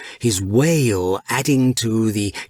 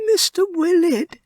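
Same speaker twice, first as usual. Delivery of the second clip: much higher, small mouse voice; Pip murmuring. A narrator's reading. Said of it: "Pip murmured".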